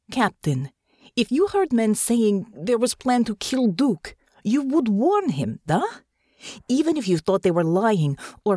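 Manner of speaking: put-on lightly Russian-accented earnest plea; Russian-accented woman. A narrator's reading. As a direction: speak with a Russian accent, urging. Natasha's accented voice.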